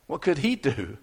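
ordinary delivery

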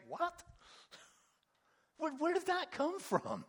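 high-pitched, laughing bewilderment; baffled disbelief. Acted. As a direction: act out a stunned "where's this coming from?". Acting out Nicodemus's baffled reaction.

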